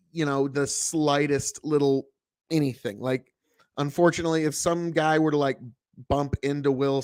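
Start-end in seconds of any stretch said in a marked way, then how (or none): none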